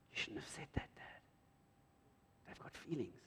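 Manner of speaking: put-on child's hushed whisper. Hurt child whispering reproach to dad.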